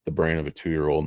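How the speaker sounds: hoarse, fading voice; losing voice. Real, not acted. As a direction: voice giving out. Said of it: "I think I'm losing my voice".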